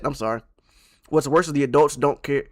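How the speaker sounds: usual speaking voice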